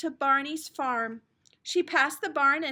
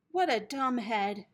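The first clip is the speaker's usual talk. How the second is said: ordinary delivery, nothing marked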